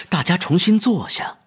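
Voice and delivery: usual speaking voice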